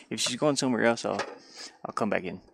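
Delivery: ordinary delivery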